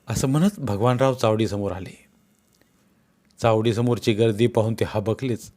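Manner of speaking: ordinary delivery, nothing marked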